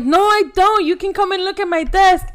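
mock indignant kid, high and loud; indignant denial. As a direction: insist you don't have it, all fake outrage. Her own denial to the teacher.

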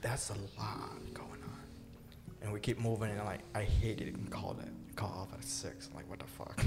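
under-the-breath grumble; muttering. Real, not acted. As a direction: mutter it almost silently. Muttered complaint about schedule.

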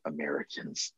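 hushed, low aside imitating disapproving locals; muttered under breath. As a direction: drop it way down, an imagined mutter. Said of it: "under their breath".

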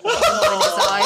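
slow, laughing punchline. Real, not acted. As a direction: drag it out while laughing.

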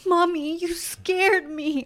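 put-on high, loud, drawn-out little-kid voice; milk it like a guilt-tripping child; mock wounded child. Child using mom's apology against her, exaggerated wounded imitation.

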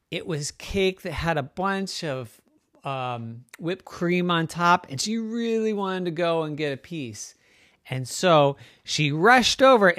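ordinary delivery

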